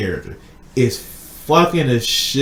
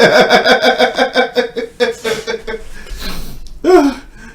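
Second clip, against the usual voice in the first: long high-pitched cackle, louder; high cackling laugh; 10 semitones higher, 4 dB louder than usual. Real, not acted. Laughing hard at his own panda story. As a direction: break into a big squealing laugh.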